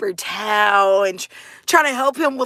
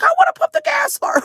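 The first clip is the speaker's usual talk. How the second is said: eager son; 4 dB louder, faster than usual. Retold or imitated. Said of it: "He's like".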